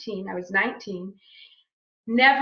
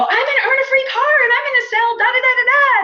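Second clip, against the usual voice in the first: high, loud, bursting with excitement; all excited; 13 semitones higher, 8 dB louder than usual. Retold or imitated. Bursting in the door to tell her husband, thrilled and loud. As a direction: gush out the news, thrilled. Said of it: "And I was, like, all excited".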